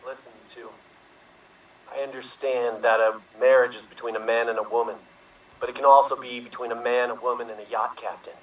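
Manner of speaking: political drama. Acted. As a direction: speak like a solemn statesman. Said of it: "as if it is a political drama".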